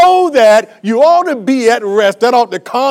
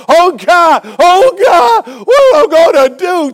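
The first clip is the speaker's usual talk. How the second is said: higher, louder hammed-up panicked cry to God; mock panic; 7 semitones higher, 6 dB louder, slower than usual. Preacher mocking a believer panicking over a burger, hammed-up cry. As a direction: throw your hands up in fake despair.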